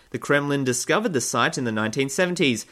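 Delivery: ordinary delivery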